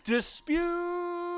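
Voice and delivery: "Disputable" softly called out with a long, high held "pu"; slow, singsong "Dispuuutable", as if calling it to dinner; stretched syllable. Shown as a demonstration. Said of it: "Stretch its name out good and long".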